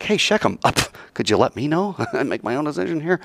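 high then fast, half-laughing protest; lightly voiced annoyed villager; mock villager complaint. A village man protesting to Shechem, mock indignant.

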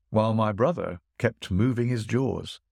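plain reading voice

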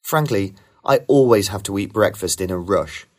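ordinary talk, nothing marked

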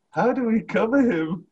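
high-pitched alarmed question; panicked movie question. Acted. Quoting Jay Baruchel's reply with no guns, high and panicked. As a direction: pitch it way up, at a loss.

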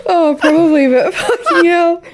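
breathless laugh-crying; half laugh, half sob; laughing through tears. Real, not acted. Podcaster still crying with laughter, laugh-crying. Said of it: "I'm crying".

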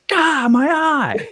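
high, slow hammed-up yelp of pain; pained cry. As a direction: cry out as if hit in the eye. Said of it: "and he's like".

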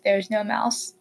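ordinary delivery

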